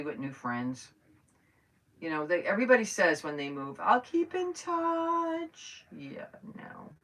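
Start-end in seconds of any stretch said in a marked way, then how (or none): 3.8-5.9 high, drawn-out sing-song mock promise; mocking quote. Mocking what movers always say. 5.9-6.9 whispered flat "Yeah, no"; whispered dismissal. Real, not acted. Dismissive aside after mock quote.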